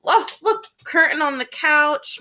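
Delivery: usual speaking voice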